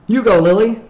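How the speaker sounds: high, loud prompt to a sister; offering the turn. A narrator's reading. Jonas lets his sister Lily go first, high and loud. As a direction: hand over the turn, pitched high and loud.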